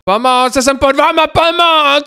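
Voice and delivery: high-pitched cry for help; shouting from below. Pretend. Player who fell below calls to friends, shouting in alarm. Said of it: "proč [name] křičí ze spodu".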